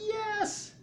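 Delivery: very high whooped yes; crowd going crazy. Acted. Crowd going crazy for the walker, excited cheer. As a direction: squeal it like a wild fan.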